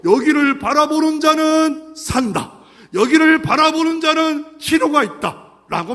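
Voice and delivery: loud, high, slow decree; booming solemn announcement; divine proclamation. Acted. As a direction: proclaim it to the whole camp. God's proclamation.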